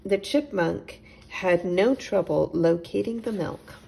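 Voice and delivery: ordinary delivery, nothing marked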